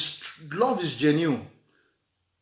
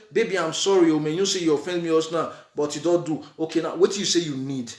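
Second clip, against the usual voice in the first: lower, softer soothing husband voice; soft apology; 6 semitones lower than usual. Retold or imitated. Husband soothing his partner after a quarrel, soft and low. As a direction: gently make peace with his partner. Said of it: "tell her, say".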